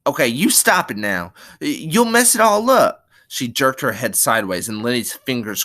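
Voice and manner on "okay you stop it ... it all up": indignant, rising to high and loud; angry cry. Acted. Curly's wife stopping Lenny's stroking, angry cry. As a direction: scold him, voice climbing sharply. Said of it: "And then she cried angrily".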